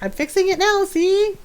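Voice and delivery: high-pitched coaxing hooray; mock cheering. Farmer showing food to upset animals, high pretend cheering.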